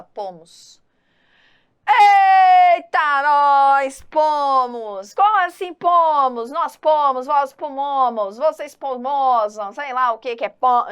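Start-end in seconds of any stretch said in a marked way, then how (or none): 1.9-10.9 shocked outrage. Acted. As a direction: exclaim in hammed-up disbelief. Professora fingindo espanto com erro. (espanto exagerado, bem alto e agudo)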